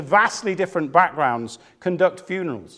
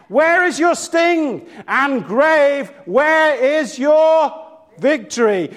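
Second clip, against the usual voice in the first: high, driving sermon climax; impassioned preaching; 5 semitones higher than usual. Real, not acted. Preacher tells how the congregation rose to celebrate, preaching fervently. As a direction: preach it out with rising fervour.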